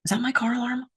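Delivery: soft, high-pitched wondering; dawning worry. Acted. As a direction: ask yourself softly, pitch lifted. Acted inner dialogue.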